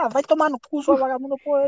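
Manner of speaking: retold angry cursing, pitched high; angry comeback. Player cursing back at his teammate, retold with high heated anger. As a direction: fire back the curse in one heated run. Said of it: "Porra, deu raiva, velho".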